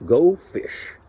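lightly voiced guru, slow and grave; a hint of a mysterious master; wise master's reply. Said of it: "and said".

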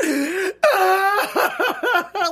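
high wailing laugh-cry; hammed-up bawling that tips into laughter; mock hysterical sobbing. Woman acts out crying in the bathroom, mock hysterical. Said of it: "I'm hysterical, and I'm like".

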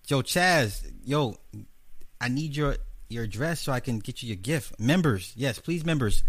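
usual speaking voice

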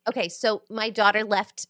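ordinary talk

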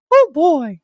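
high falsetto cartoon mouse; high, loud Mickey voice; Mickey Mouse impression. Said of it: "And he gets, like, people all just".

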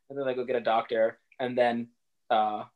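ordinary talk

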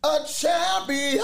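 high shout with a strained voice; lost voice. Acted. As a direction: push it out with no voice left. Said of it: "I have no voice".

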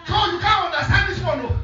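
very high, laughing comeback; mock quarrelling. Preacher retells answering the colleague, high mock-indignant taunt. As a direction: throw it back in comic outrage.

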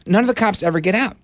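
normal talking voice

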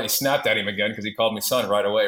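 usual speaking voice

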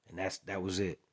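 usual speaking voice

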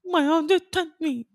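very high-pitched mock whine; hammed-up wounded impression; Amanda. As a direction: squeak out a hurt protest. Mimics Amanda in retold quarrel.

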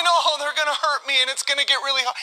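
ordinary talk

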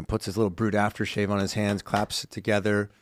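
normal talking voice

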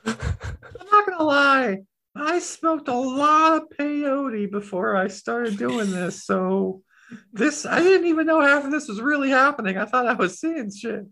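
high, spaced-out cartoon confession; high-pitched talking needle.

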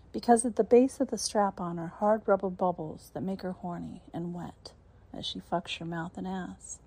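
ordinary delivery

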